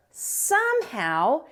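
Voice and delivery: ordinary delivery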